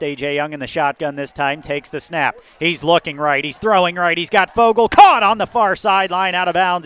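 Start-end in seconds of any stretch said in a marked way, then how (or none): 2.6-6.9 rising, louder as the catch lands; building excitement on the call; excited play-by-play. Real, not acted. Completed sideline catch, live call.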